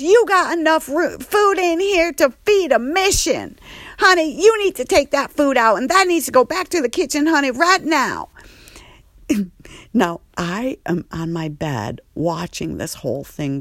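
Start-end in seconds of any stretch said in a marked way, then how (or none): 0.0-8.3 very high, loud, fast sassy scolding; Andre freaking out. Retold or imitated. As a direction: staff member ordering the food out right now. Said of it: "He goes".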